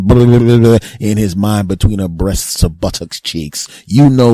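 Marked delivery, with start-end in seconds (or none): 0.0-0.8 loud lip-flapping blubber; mock motorboating noise. Guy imagining himself between breasts, blubbering loudly. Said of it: "and going".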